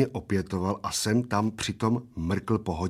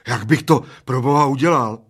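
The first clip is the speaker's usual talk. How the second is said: raised, loud protest of the little man; screeched; 4 semitones higher, 9 dB louder than usual. A narrator's reading. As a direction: screech it in exasperation. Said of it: "vřeštil maličký". (vřeštivě, hlasitě a výš)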